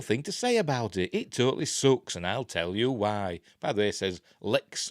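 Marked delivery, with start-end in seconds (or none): none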